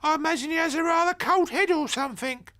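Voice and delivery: put-on high, loud old granny; squeaky elderly lady chatter. A narrator's reading. Grandmother chatting to Ben, high and loud. Granny voiced in story read.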